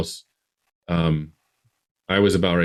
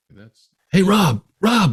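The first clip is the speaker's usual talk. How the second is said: high, loud call across the street; yelling for brother; 13 semitones higher, 7 dB louder, slower than usual. Retold or imitated. Boy yells to his brother about a light in the sky, loud and high. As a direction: shout his name to get his attention. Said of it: "And I yell at him".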